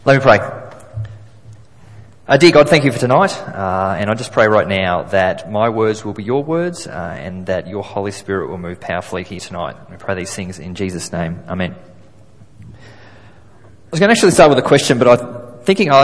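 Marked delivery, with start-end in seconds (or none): 2.3-11.8 earnest prayer, hushed toward the end; praying. Acted. Opening prayer before the talk.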